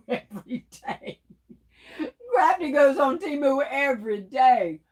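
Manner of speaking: normal talking voice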